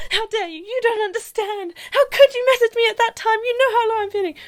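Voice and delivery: high, loud indignant protest; hammed-up hurt fury at a friend; mock outrage. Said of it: "At the time, I was like".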